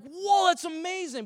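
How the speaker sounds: high, loud burst of amazement; amazed. Retold or imitated. Listener hearing of the healing, amazed. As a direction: gasp it out as good news. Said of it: "you're like".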